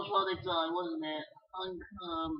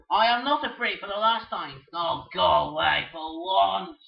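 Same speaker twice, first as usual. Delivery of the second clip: angry yelling; 7 dB louder than usual. Acted. Bird shouting at the other.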